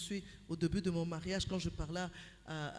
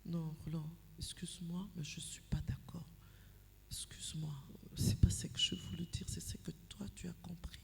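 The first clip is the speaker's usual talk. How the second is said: much softer, near-whispered disagreement; gentle hushed objection; lowered tone; 2 semitones lower, 6 dB quieter than usual. Shown as a demonstration. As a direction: say it softly to your husband, no edge. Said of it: "Je baisse d'un ton".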